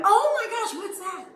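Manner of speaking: very high-pitched luring coo; feigned excitement. Retold or imitated. Owner luring runaway dog closer, high feigned excitement. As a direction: fake amazement to catch a dog's interest. Her trick to lure the dog.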